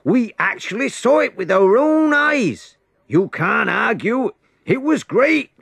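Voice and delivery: high, loud, eager reporting; breathless excitement. A narrator's reading. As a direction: blurt out what you saw. Witnesses rushing to Pharisees.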